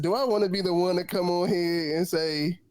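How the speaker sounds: ordinary talk, nothing marked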